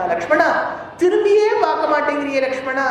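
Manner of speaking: acted plaintive call, pitched well up. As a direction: call after someone who won't turn round. Sita calls to Lakshmana, who won't turn.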